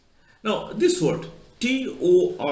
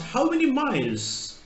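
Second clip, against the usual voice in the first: higher, slow Australian "miles" sounding like "males"; Australian accent; 8 semitones higher, slower than usual. Retold or imitated. Australian travel agent asking an Indian, put-on Australian accent. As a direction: mimic the travel agent's Aussie vowel. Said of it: "Australian speaks".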